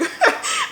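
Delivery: sudden squeaky laugh; short high giggle; real laugh breaking through; high laugh burst. Real, not acted.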